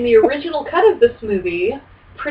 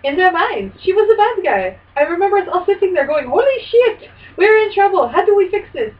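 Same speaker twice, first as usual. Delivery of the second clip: put-on higher, excited Finnish-accented director voice; Renny Harlin accent; 6 semitones higher than usual. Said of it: "you gotta say it in the Renny Harlin accent".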